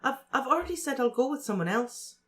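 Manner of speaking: put-on high, halting girl's voice, sorry; apologetic Cho. A narrator's reading. Cho apologising to Harry, high and halting. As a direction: stumble gently through the apology.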